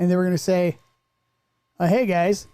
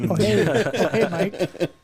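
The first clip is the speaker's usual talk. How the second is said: sultry mock greeting; 3 semitones higher, 4 dB louder, much slower than usual. Magic Mike joke, sultry greeting.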